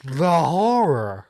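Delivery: slow, deadpan mock horror; sarcastic. Real, not acted. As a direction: drag it out with irony. Sarcastic reaction to advice.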